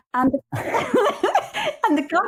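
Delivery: real laughter breaking through the words; laughing. Real, not acted. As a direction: laugh while trying to go on. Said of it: "Are you laughing".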